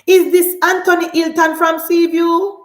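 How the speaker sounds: loud, disbelieving call-out; incredulous challenge. Acted. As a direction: challenge him loudly, rising at the end. Mock confronting the politician.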